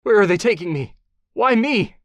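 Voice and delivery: high, loud frightened cry; panicked captive. Acted. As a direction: panic rising in the dark. Captive panicking in car trunk.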